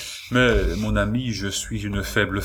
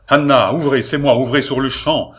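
commanding shout, higher and much louder; loud shout through the door; 5 semitones higher, 8 dB louder than usual. A narrator's reading. The marquis shouts through the door at Anna, demanding. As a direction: pound the door with your voice. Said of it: "une voix forte cria".

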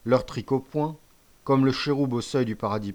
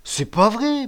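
high, loud, frightened denial; panicked stammer; 11 semitones higher, 6 dB louder, slower than usual. A narrator's reading. Accused boy before the band, frightened stammering denial. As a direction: protest in a pitched-up panic. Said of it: "balbutia le bancal qui rougissait".

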